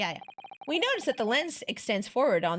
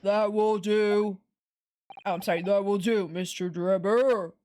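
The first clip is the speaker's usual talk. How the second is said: robot voice. Acted. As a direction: drone like a robot judge. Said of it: "doing the robot voice for him".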